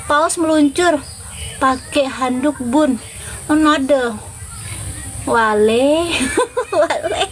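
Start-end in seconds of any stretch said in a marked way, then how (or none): none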